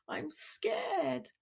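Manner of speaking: put-on a little boy whispering that he is afraid; a tiny scared whisper; scared child whisper. Child confesses fear.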